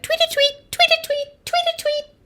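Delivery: very high, quick twittering; starling tweeting. A narrator's reading. Starling keeping Owl awake, high twittering. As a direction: tweet it in a tiny high bird voice. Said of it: "Starling said".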